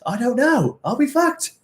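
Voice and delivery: high, alarmed squeak of a question; mock panic. Real, not acted. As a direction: play up a comic worry. Mock panic after Brexit joke.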